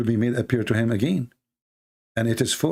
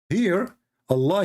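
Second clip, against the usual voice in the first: normal talking voice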